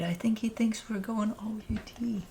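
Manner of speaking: ordinary talk, nothing marked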